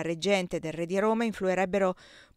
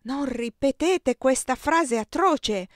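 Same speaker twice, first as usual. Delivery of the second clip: blurted out; 6 semitones higher, 7 dB louder, slower than usual. A narrator's reading. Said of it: "mi sfuggì di bocca".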